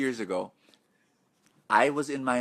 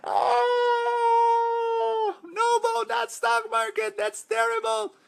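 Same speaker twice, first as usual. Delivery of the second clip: gasping, much higher pitched, hammed-up panic; mock horrified skeptic; 4 dB louder, much slower than usual. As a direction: play a scared investor squealing at the idea. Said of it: "That's the reaction of a lot of people".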